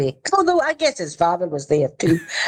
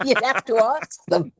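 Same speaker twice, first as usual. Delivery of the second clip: amused, laughing through the words; 5 semitones higher than usual. Real, not acted. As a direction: say it higher, breaking into real laughter. Jokes about co-stars, laughing.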